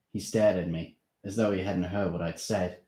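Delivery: usual speaking voice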